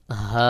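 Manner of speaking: হা breathed freely from the throat, held; long Arabic হা without throat pressure; fully open হা. Shown as a demonstration. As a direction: let air out on an open, unblocked হা. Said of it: "একেবারে খোলাভাবে আওয়াজটা".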